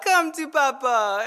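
put-on drawn-out playful call to a child; coaxing dad. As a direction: voice a dad luring his little child. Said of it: "then he picks him back up".

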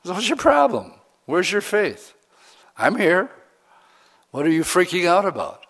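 high-pitched, exasperated questioning; frustrated Jesus. Acted. Pastor voicing Jesus to doubting believers, frustrated. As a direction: challenge them, pitch jumping up in frustration. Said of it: "we frustrate him probably all the time".

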